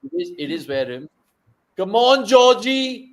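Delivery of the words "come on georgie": cheering on. Real, not acted. Cheering the batter on.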